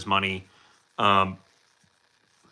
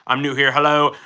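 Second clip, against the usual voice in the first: normal talking voice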